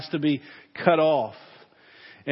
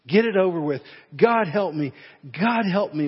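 whimpered; 3 semitones higher, 4 dB louder than usual. Acted. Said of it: "whimpered the man".

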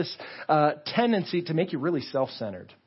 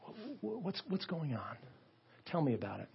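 hushed listening; 12 dB quieter than usual. Acted. God stooping to hear a plea, hushed. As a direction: lean in softly as if inviting a secret.

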